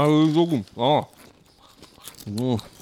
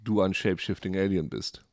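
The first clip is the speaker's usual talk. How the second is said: normal talking voice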